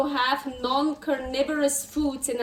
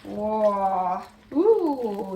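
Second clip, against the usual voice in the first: ordinary talk, nothing marked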